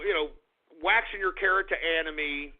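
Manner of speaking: usual speaking voice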